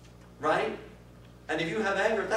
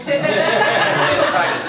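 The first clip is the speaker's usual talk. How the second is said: slow and laughing, higher and louder; 13 semitones higher, 15 dB louder, much slower than usual. Real, not acted. Teacher laughing as she repeats her motto to the class. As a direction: laugh your way through the catchphrase.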